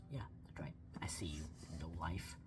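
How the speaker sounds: low, quiet creepy teasing; joking low-voiced jab; mock taunt. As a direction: pretend to call out the viewer, hushed.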